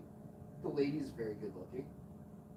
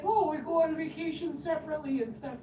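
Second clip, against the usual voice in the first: high neighbor woman voice; 11 semitones higher, 4 dB louder than usual. Retold or imitated. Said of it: "And she's like".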